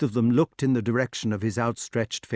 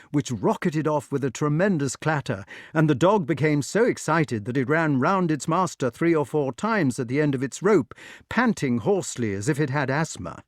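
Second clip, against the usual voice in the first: ordinary delivery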